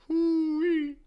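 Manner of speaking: high, loud whoop of delight; hype whoop. Real, not acted. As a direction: let out a gleeful hoo-wee. Hype at a scene entrance.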